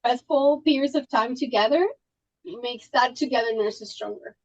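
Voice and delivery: ordinary talk, nothing marked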